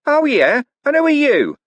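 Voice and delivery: high, loud suspicious challenge; glaring challenge. Acted. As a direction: throw the question back with a glare. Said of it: "glaring at him".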